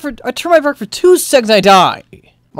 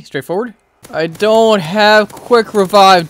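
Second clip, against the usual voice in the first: ordinary talk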